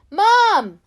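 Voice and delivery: loud, high cry of relief. A narrator's reading. Child crying out to Mum at the door, loud. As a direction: call out to her as you run. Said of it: "I cried out".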